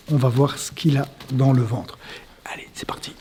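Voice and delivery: low hushed whisper. Acted. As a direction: breathe the words out, barely voiced. Coach hypes up the fight.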